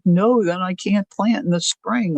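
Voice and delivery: ordinary talk, nothing marked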